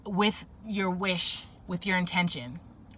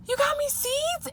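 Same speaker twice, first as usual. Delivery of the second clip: very high, thrilled squeal of a question; gasp, then ask in delighted disbelief; acted childlike delight at a gift; genuine excitement; 4 dB louder than usual. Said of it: "you're genuine and you're excited".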